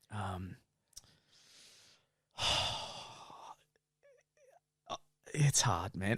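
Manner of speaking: soft, sighing mock heartbreak; soap-opera sorrow, lightly acted; hammed-up sad confession. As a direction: play the wounded friend a bit overdone. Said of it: "going into acting mode".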